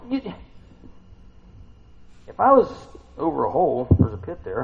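ordinary talk, nothing marked

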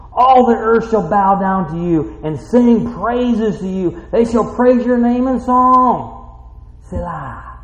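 loud, high preaching read, slowing at the end; full-force pulpit proclamation; preaching scripture. Acted. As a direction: declare the verses over the church. Reads the psalm at full force.

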